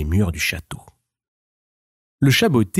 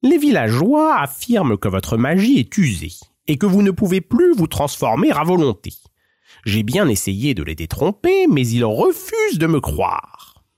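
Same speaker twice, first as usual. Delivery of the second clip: higher, courtly cat voice bowing to the ogre; a sly, flattering little cat; Puss in Boots; 6 semitones higher than usual. Acted. Cat flatters the ogre on his throne, higher courtly voice.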